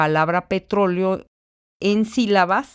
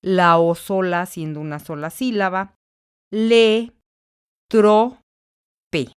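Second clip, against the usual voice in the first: "le", "tro", "pe" said apart, with pauses between, "tro" raised; split into syllables. Shown as a demonstration. Teacher splitting petróleo into syllables. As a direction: sound out the syllables one by one. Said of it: "la separación silábica tendrá que ser".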